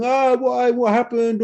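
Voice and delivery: high, whining mock wail of a burned investor; hammed-up high whine of a panicked coin holder; whiny losing investor. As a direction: moan it like a crying buyer who lost everything. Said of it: "People start complaining and crying, going".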